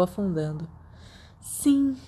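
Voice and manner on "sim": bright. A narrator's reading. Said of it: "disse Lily brilhantemente".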